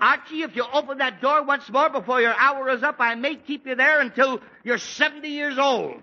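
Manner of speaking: high, loud threatening bellow; angry yell. Acted. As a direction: warn him off through the door. Father yells through door.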